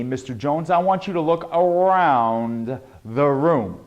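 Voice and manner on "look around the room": slow, deliberate "around the room"; carried-out words. Shown as a demonstration. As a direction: draw AROUND out slowly. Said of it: "When you carry out your words".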